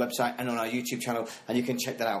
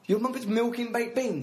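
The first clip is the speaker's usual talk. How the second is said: surprised; 9 semitones higher than usual. Real, not acted. Incredulous echo of friend's claim.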